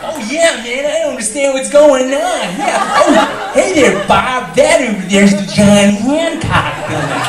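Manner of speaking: high, loud American twang; hammed-up American tourist accent; exaggerated American accent. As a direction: open the mouth wide and twang it like an American. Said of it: "Americans, for example, they open their mouths very wide".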